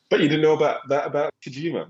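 quick London accent attempt; London accent, fluffed and rushed. Shown as a demonstration. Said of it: "trying to say in a London accent".

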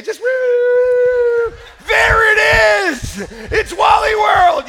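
slow, high, loud thrilled yelling; excited arrival shout. Acted. Dad yelling as the family arrives at Wally World, thrilled shout. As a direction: belt it out like a kid spotting the park.

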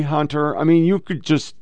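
ordinary delivery

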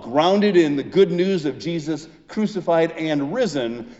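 ordinary talk, nothing marked

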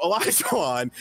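ordinary talk, nothing marked

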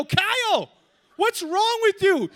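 high, loud, stretched indignant shout; acted outraged yell. Storyteller to the teammate who shot him, outraged yell. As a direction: holler at the friend who betrayed you.

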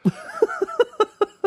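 high-pitched burst of laughter; helpless giggling laugh. Real, not acted. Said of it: "Sorry, I just".

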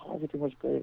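mock sweet "everything was great"; sarcastic. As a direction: pretend all is fine. Sarcastic reply in story.

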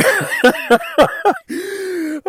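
long high laugh. Real, not acted. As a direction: laugh it off sheepishly. Laughing admitting strip club.

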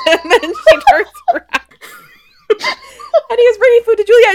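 real laughter pushing the words high and fast; laughing. Real, not acted. Host retelling the scene, cracking up. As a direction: gasp it out through laughter.

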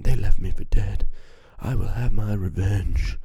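hammy, loud, slow movie-hero growl of a line; mock action hero. Mocking a generic revenge movie, hammy hero voice. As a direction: parody a brooding revenge hero, big and drawn out.

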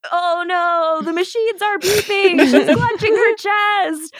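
high-pitched hammed-up panic, laughter creeping in; mock alarm. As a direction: play the medical emergency as comic panic. Mock alarm at retold heart attack.

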